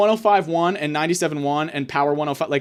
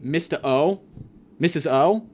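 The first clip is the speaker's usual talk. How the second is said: ordinary talk, nothing marked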